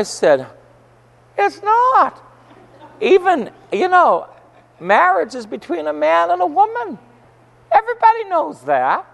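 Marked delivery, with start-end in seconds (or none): 1.4-9.1 emphatic retort. Retold or imitated. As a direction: firmly declare it, voice raised high. Retold heated reply to the angry man.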